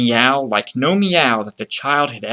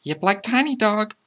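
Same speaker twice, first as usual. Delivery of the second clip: put-on high child coaching a pet; high-pitched, urging child; child's voice; 10 semitones higher than usual. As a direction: instruct in a high little-kid voice. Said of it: "the child told My Dog".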